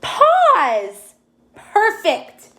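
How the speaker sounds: high, loud, drawn-out exclamation; delighted reveal. A narrator's reading. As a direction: burst out with the happy answer. Big reveal, Dog is delighted.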